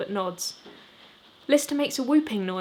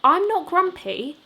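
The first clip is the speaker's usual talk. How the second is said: indignant protest; 9 semitones higher, 8 dB louder, slower than usual. Acted. Jimmy protests, louder.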